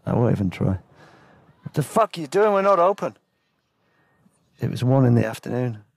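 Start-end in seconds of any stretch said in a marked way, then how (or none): 1.6-3.2 high-pitched irate receptionist; angry. Acted. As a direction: act out an angry man woken from sleep. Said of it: "Nah, he was angry anyway".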